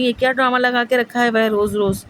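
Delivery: a mother fed up, snapping back at the fuss; retold angry scolding. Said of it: "امی کو تو پھر غصہ آ گیا".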